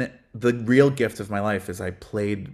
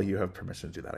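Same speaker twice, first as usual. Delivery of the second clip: ordinary talk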